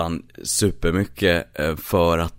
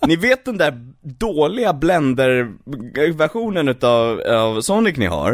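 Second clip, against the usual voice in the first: high, laughing corporate pitch; Disney calling Paramount, mock high exec voice; 8 semitones higher than usual. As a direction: play a giddy studio exec with a money bag. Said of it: "Disney ringer och bara".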